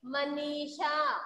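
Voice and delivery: Manisha read slowly, syllables drawn out; slowed-down Manisha. Shown as a demonstration. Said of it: "क्या बोलेंगे?".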